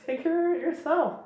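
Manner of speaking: high mock voice, irritated and done; fed-up queen. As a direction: hand it off in an exasperated falsetto. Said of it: "Rudaka at this point is just like".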